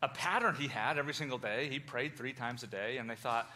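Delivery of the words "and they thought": normal talking voice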